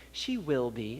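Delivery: quiet, low retold reassurance; imitating a hushed, noncommittal answer; guarded reply. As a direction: drop to a soft, low, careful answer.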